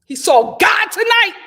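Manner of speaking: loud, high exclamation; startled yell; shout. Real, not acted. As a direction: blurt it out at full volume. Shouting reaction to bug.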